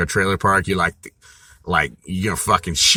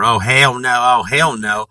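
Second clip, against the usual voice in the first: louder, higher mock outrage; pitched-up mock sass, a bit louder; mocking loud girl; 5 semitones higher, 6 dB louder than usual. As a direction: mimic her shouting back. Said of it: "getting louder".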